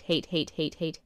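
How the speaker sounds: normal talking voice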